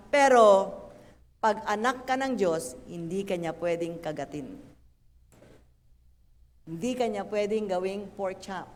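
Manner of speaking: normal talking voice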